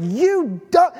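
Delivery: playacted cursing at a dog; mock anger. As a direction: imitate a fed-up scolding, cut short. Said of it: "He is so mad".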